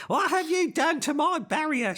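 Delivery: high, loud furious shriek of the woman with the torch; furious scream. Acted. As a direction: scream it at them, outraged. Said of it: "she screams, furious".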